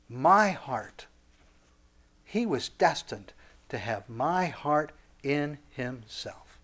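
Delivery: slow, hushed solemnity; voice of God. Acted. God giving his heart and commandment, slow and hushed. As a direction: let each phrase land softly, as God. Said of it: "And God says".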